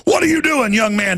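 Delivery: put-on high, barking officer challenging a kid; bossy officer. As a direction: play a bossy agent scolding a child. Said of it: "they run over and say".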